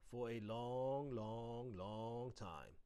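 slow, stretched-out "long, long, long"; drawn-out emphasis. A narrator's reading. Narrator telling of a lengthy broom balancing trick, drawn out. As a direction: draw each "long" right out.

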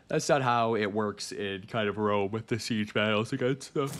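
talking through a yawn; words stretched by a yawn. Real, not acted. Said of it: "I'm yawning".

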